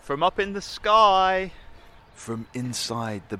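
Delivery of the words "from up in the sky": big booming trailer narrator. Pretend. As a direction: announce it like an epic documentary. Mock grand trailer presenter.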